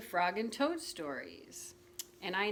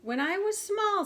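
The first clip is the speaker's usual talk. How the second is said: much higher, louder voice to open the tale; storyteller Frog; 12 semitones higher, 6 dB louder than usual. Acted. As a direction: pitch it way up to start the spooky story. Frog begins his spooky tale.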